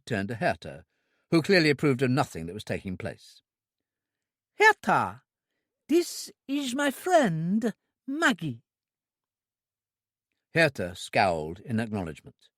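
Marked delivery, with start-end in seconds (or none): 4.6-8.6 put-on high, slow simple girl's voice; an eager, innocent young woman; high, drawn-out childlike voice; childlike Trudy. A narrator's reading. Trudy introduces friend.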